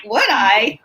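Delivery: playful rising-and-falling swoop on the question; mock-eager reply. Guest replying to invitation to praise her coach, mock-eager. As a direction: act delighted to be asked.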